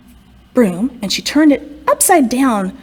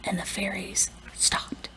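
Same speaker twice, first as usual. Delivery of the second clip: whispered hush; 2 semitones lower, 15 dB quieter than usual. A narrator's reading. Narrator hushes as the fairies fall silent, whispering. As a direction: whisper it, the house gone silent.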